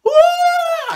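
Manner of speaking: very high, loud ooh; amazed shout. Retold or imitated. Kid dazzled by colourful comic pages, high loud ooh. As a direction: gasp out loud at the bright pictures. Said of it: "and I'd be like".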